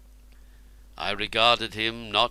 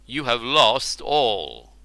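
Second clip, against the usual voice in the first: cold verdict; 5 dB louder, slower than usual. A narrator's reading.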